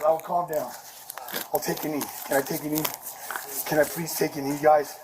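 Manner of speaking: low, quiet and pleading, giving up the fight; subdued surrender. Real, not acted. Suspect surrendering to cops, quiet and pleading. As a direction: back down and ask softly to kneel.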